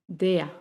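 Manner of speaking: "der" ending in an open A sound; vocalized final R. Shown as a demonstration. Teacher demonstrating "der" with final R as A. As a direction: let "der" glide into A, no R. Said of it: "suena como una A al final".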